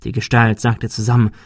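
ordinary delivery

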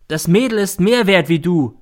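high, loud defiance; angry retort. A narrator's reading. Son defending the girl against mother, angry. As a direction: hurl it back.

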